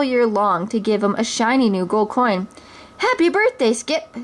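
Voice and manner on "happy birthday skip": higher, cheery little sister; a child wishing happy birthday; sister's greeting. Acted. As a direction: greet your brother joyfully.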